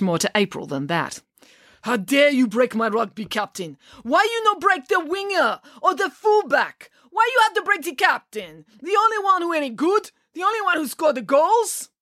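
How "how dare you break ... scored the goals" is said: put-on thick Russian accent, high-pitched outrage; Mister Popov. A narrator's reading. Coach berating the girl over his captain, outraged Russian accent. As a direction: rant indignantly in a heavy Russian accent. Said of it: "in his thick Russian accent".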